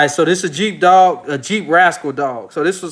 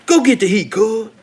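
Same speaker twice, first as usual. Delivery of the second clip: put-on higher, laid-back West Coast accent like [name]; imitating [name]; 5 semitones higher than usual. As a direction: mimic a Long Beach rapper telling the homies to grab the heat. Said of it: "He said".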